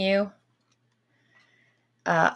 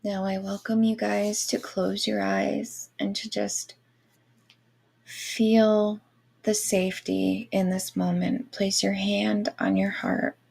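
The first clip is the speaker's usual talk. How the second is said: airy breathy mystical healer. Acted. Guided Reiki meditation.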